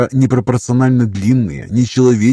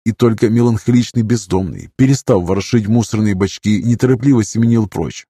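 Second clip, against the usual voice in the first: ordinary delivery, nothing marked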